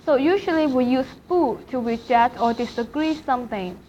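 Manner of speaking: ordinary delivery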